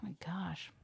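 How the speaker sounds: whispered, barely voiced reaction; hushed dismay. Real, not acted. Co-host hears flat tires were common, hushed dismay. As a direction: breathe it out under your breath.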